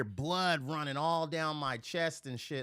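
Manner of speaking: ordinary delivery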